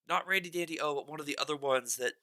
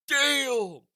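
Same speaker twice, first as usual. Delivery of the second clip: loud, high drawn-out burst, falling away; impressed exclamation; 9 semitones higher, 6 dB louder than usual. Retold or imitated. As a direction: let it out in awe. Acting out amazement at singer.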